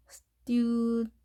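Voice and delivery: "student" drawn out very slowly, no e before n; syllable-by-syllable demo. Shown as a demonstration. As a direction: stretch "student" out as "styoo-dnt". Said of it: "발음결 보면". ("student"를 "스튜-든트"로 천천히 늘여 발음)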